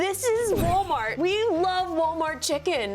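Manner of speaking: excited. Real, not acted. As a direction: draw it out like a proud announcement. Proud reveal of cheapest chicken.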